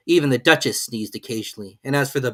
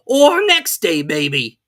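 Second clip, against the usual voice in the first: high, slow, unbothered footman; footman, same tone; 6 semitones higher, 11 dB louder, much slower than usual. A narrator's reading. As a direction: keep the lofty calm. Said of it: "in the same tone".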